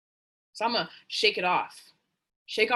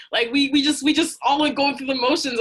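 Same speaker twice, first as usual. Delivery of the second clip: higher and louder, venting; animated outburst; 6 semitones higher, 7 dB louder than usual. Real, not acted. Speaker venting about going through the motions, loud and animated. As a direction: push it out louder, half laughing.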